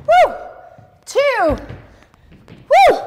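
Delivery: a loud, high whoop of triumph; excited cheer. Real, not acted. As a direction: shout it out to celebrate the last rep. Cheering during the workout countdown.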